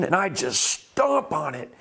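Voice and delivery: normal talking voice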